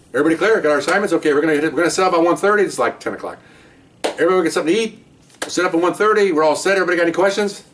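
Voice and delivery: rally the squad like a sergeant at a briefing; commanding briefing voice. Retold or imitated. Supervisor addressing briefing room.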